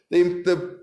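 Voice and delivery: ordinary delivery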